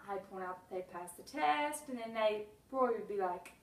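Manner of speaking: ordinary delivery, nothing marked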